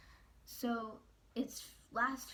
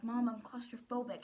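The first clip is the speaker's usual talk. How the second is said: a louder, pleading retold yell; yelling at mom; 3 semitones higher, 8 dB louder than usual. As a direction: push back at mom, louder. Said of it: "I'm yelling at my mom about this".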